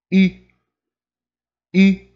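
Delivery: I said with lips frozen round, like French u; French u sound. Shown as a demonstration. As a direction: say I without moving the rounded lips. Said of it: "pronunciar a letra I sem mexer os lábios".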